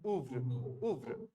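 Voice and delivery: ordinary delivery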